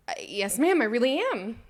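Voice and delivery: loud, high, overdone cheerful assurance; eagerly happy. Retold or imitated. Employee convincing her testing boss she is happy, eagerly cheerful. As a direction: push the happiness up brightly to convince. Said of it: "why don't you sound like it".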